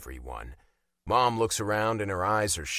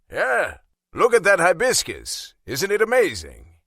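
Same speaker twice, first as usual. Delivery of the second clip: loud, higher-pitched delight; enthusiastic dad; 9 semitones higher, 9 dB louder than usual. Acted. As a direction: show off the garden with big enthusiasm. Dad proudly admiring the garden.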